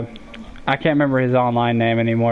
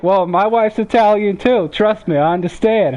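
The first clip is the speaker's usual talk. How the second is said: higher, emphatic Jersey guy; punchy mock Jersey voice, pitched up; imitated Jersey accent; 8 semitones higher, 3 dB louder than usual. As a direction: commiserate like a Jersey husband. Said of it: "The hardcore Jersey accent".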